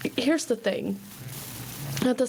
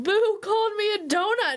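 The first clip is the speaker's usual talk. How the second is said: put-on much higher, whiny child complaining; upset kid; 12 semitones higher than usual. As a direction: tattle like a hurt little boy. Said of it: "Bubba will be like".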